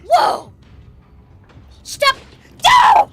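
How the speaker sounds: short, very loud high cries; panicked shouting. Real, not acted. Player losing control as enemies attack, panicked shouts. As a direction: escalate to a high-pitched scream.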